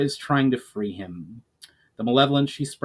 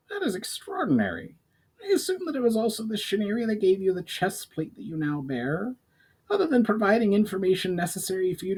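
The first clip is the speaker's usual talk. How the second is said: higher goblin, curious and probing; 9 semitones higher than usual. Acted. Goblin questioning the elf about the elementals, higher voice. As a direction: pitch up and question eagerly.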